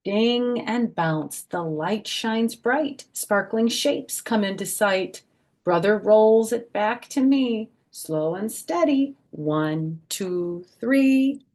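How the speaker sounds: bouncy rhyming picture-book voice; reading to children. Acted. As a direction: read the rhyme aloud to a group of little kids. Reads rhyming picture book aloud.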